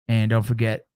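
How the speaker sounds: usual speaking voice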